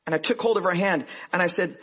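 normal talking voice

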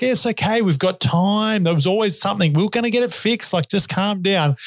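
lightly mocking his own calm voice; calming reassurance. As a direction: talk a mate down, soothing and lifted. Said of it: "And I was just like".

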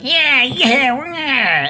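high, loud mock goblin cheer. DM voicing goblin celebrating a kill, high and loud. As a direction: gloat like a little goblin. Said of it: "It's like".

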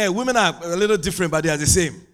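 ordinary talk, nothing marked

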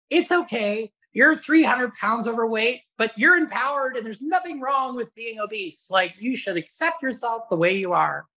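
high, sugary mock-soothing voice; overly accepting cheerleader. Mocking the accept-yourself crowd, in a high cooing put-on voice. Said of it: "people on the other extreme that are like".

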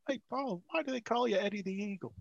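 high-pitched retold question to the boss; innocent question. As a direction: re-enact asking, pitched right up. Acting his younger self asking boss.

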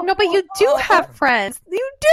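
hammed-up heartbroken reassurance; mock tearful protest. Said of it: "I literally just cry".

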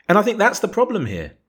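usual speaking voice

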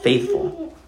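faithful floated up high and soft; faithful sung out high and quiet; lifted emphasis. Shown as a demonstration. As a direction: let the word faithful rise high and gentle. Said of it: "he shows himself".

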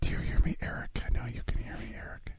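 spooky ghost whispering to Eric. Pretend. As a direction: whisper like a haunting spirit. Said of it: "A ghost on this recording".

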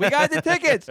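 very high, loud retold cheer; thrilled announcement. Dad telling son they got seats, thrilled. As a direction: shout the win like dad. Retelling dad's excited phone call.

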